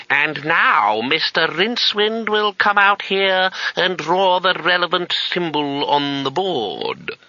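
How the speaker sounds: high, slow schoolmaster drone; old teacher voice. Retold or imitated. Schoolmaster calling a pupil up, slow and high. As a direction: summon the pupil to the board like a stern old master. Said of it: "and the voice saying".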